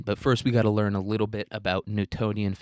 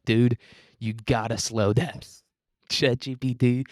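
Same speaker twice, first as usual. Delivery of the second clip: mock exasperation at a chatbot; drawn-out, overwhelmed protest; exasperated plea; 3 semitones higher than usual. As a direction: beg it to ease off, half laughing. Replays pleading with the chatbot.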